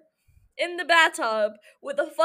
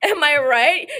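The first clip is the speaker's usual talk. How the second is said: normal talking voice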